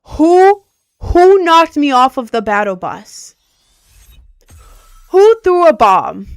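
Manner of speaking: loud, indignant interrogation; angry demand. Real, not acted. Player hunting whoever threw the bomb, loud angry demand. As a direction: hit each "who" high and loud. Demanding who knocked them off.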